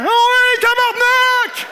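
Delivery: high, loud, slow oui, tabarnak; mock shout. Crossing guard swearing, loud mock shout. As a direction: bellow it out. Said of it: "un brigadier faire".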